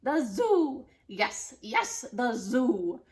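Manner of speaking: loud eager witch; excited witch. Acted. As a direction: seize the idea gleefully. Witch has idea.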